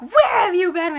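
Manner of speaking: high, loud mock-mother shout; scolding mom. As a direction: imitate a mom demanding an explanation. Mom scolding the sisters, acted.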